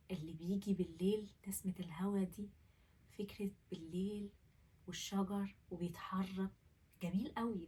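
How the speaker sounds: hushed unvoiced whisper. Whispering about the night breeze by the open window. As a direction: let the words drift out on breath.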